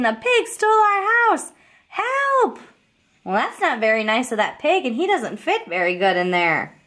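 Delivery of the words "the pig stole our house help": scared chickens fleeing the pig, shrieking high for help; high, panicked chicken cry for help. Acted. Chickens fly away crying help.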